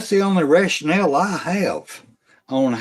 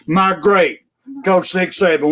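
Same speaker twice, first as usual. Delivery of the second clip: usual speaking voice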